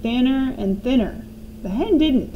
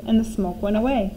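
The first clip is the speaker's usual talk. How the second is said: plain reading voice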